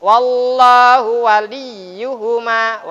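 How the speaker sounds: Wallahu waliyuhuma chanted very slowly, drawn out; tajwid recitation. Shown as a demonstration. Teacher showing the class how to recite it. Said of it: "Wali dibaca".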